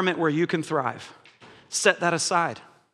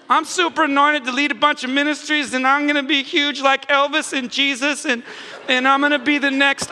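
mock boastful believer; 6 semitones higher than usual. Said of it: "mocking ambitious believer".

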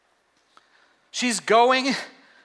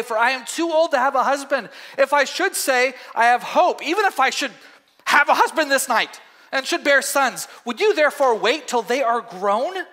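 Naomi's urgent plea, pitched higher; Naomi pleading; 5 semitones higher than usual. Acted. Reads Naomi's speech.

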